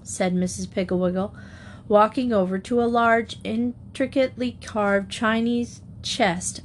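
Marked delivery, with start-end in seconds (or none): none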